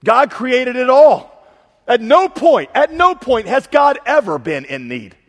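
slow, loud, high declaration; full-force preaching. Real, not acted. Preacher declaring God's sufficiency to the congregation, loud and slow. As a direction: thunder it out to the room.

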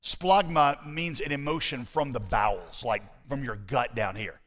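ordinary talk, nothing marked

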